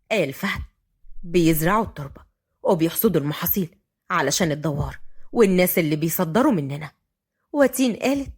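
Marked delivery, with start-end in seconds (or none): 1.2-6.9 low, matter-of-fact male voice; Fahd explaining. Acted. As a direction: explain it in a deep, composed voice. (بصوت فهد الواطي)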